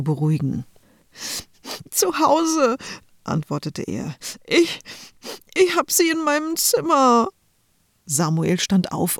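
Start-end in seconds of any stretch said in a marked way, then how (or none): 1.9-2.8 put-on sobbing little boy. Crying little brother answers. 4.5-7.3 put-on high, tearful small child's voice; a little boy wailing over his lost cap; little brother crying in the changing room, high and tearful; sobbing little boy.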